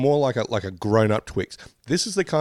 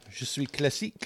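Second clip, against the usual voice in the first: put-on French accent; 4 semitones higher, 9 dB quieter than usual. Playing a posh French snack.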